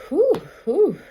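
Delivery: high, loud breathy whew whew; high whooshing whews. Retold or imitated. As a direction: blow it out, shrill and relieved. Exhaling held breath.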